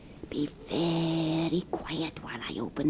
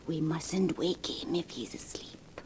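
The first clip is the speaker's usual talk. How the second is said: hushed whisper so as not to wake him; soft conspiratorial whisper; 8 dB quieter than usual. Acted. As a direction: breathe it out, barely voiced. Tiptoeing into sleeping man's room.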